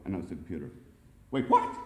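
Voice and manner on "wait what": very high, loud disbelief; startled exclamation. A narrator's reading. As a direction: yelp it in shock. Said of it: "exclaimed Jerry".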